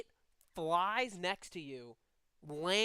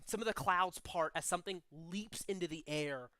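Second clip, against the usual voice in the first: usual speaking voice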